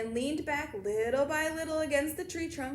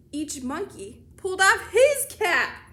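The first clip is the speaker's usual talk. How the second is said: loud, high and drawn out; slow, punched storybook climax; excited reveal; 5 semitones higher, 9 dB louder, slower than usual. A narrator's reading. Reader reveals the monkeys copying him, excited.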